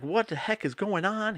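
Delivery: imitating a freaked-out reaction; mock freaking out. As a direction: lightly act out someone freaking out. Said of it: "Hugh's freaking out. He's like".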